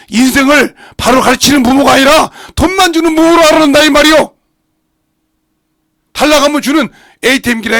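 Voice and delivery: loud, rising sermon cry; full-force preaching. Acted. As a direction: hammer the point home to the congregation.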